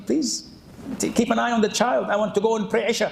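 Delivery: high-pitched, imploring request; pleading wife. Acted. Wife asks husband to watch the child, pleading.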